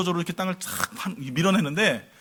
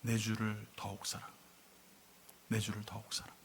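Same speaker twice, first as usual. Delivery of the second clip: very soft, low hymn words; faint dying praise; 6 semitones lower, 14 dB quieter than usual. Retold or imitated. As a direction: imitate a martyr's last murmured praise. Dying pastor's hymn words, retold.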